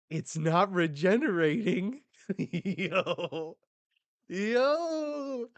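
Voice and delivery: loud, high-pitched disbelief; astonished outcry. Acted. Manga fan seeing an arm not regenerate, astonished. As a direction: whoop in amazement, laughing. Thrilled reaction to shocking panel.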